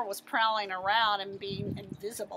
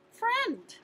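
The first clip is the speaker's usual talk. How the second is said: eager answer; 10 semitones higher than usual. A narrator's reading. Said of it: "I said".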